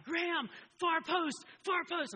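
high, loud, slow sideline scream; screaming at son. Retold or imitated. Dad on the sideline to his son, a loud slow scream. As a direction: yell it across the pitch. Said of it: "And I'm screaming at him".

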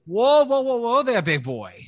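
high, loud whoa to calm a brute; placating halfling. Acted. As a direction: hold up your hands and soothe him. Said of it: "He goes".

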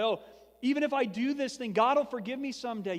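mock the reckless young voice that thinks nothing matters; careless youth. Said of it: "A lot of us think that way".

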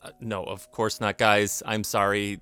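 usual speaking voice

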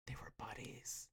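gasp, then a shocked whisper. Real, not acted. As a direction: breathe it like a secret. Gasped shocked aside.

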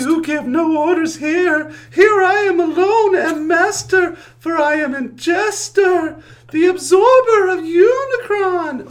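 high, slow, wailing ghost. Acted. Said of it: "supposed to be roaring like thunder".

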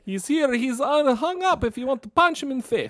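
high-pitched comic Armenian gangster; Armenian gangster accent. Acted. Crewman offering up the handcuffed captain, mock Armenian gangster accent. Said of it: "It's like Armenian gangster".

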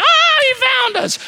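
put-on delighted children caught; excited kids. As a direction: grandkids found in pantry, squealing high. Grandkids caught in hide and seek.

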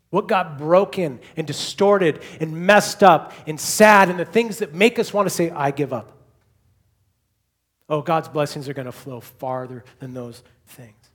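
loud, driving sermon crescendo; impassioned preaching. Real, not acted. Preacher promising the church one day all will be mended, impassioned. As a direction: ring out each promise with force.

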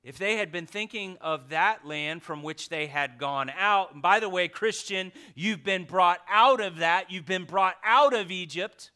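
fervent pulpit reading; impassioned scripture reading. Real, not acted. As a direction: read it out like a herald. Preaching at full force.

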